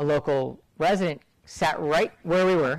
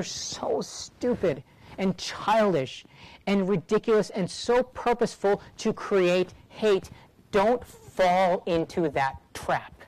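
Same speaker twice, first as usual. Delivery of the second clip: slow emphatic scorn; 2 semitones higher, much slower than usual. Real, not acted. Slow emphatic condemnation of tweets.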